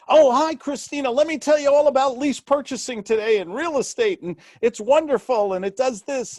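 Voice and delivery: mock salesperson gushing at a client; pushy salesperson. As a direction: overeager salesman who will not stop. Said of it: "we don't sound like the doctor".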